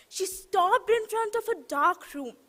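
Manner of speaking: plain reading voice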